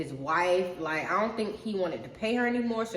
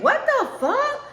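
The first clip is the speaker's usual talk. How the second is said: high, loud and slow disbelieving cry; shocked mom exclamation; 9 dB louder, slower than usual. Retold or imitated. Mom hearing what the brother did, slow shocked cry. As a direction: let the mom's disbelief stretch out loud.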